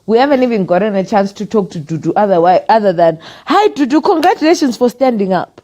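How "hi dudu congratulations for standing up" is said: high-pitched quoted greeting; acted congratulations. Imitating a quick greeting to a candidate, high congratulations. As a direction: pitch it up as a cheery hello.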